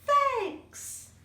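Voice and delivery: high, loud happy thanks; the whole group beaming it out; cheerful thanks. Acted. All friends say thanks together.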